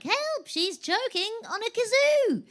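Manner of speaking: high, drawn-out mock cry for help; panicked bystander. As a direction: call out in mock alarm, pitch way up.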